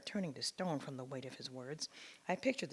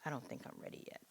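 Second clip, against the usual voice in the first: whisper; 7 dB quieter, faster than usual. Real, not acted. Telling son, worn out.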